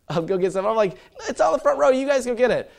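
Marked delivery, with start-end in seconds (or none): 1.3-2.7 dad insisting. Retold or imitated. Dad answering kids.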